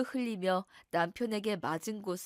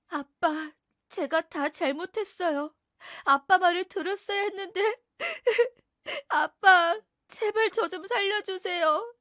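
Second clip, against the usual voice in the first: much higher, sobbing, begging; crying plea to father; 9 semitones higher, 7 dB louder than usual. Acted. Daughter crying out to her father for rescue, high sobbing plea. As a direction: beg Dad to save her, weeping.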